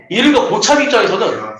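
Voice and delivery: ordinary talk, nothing marked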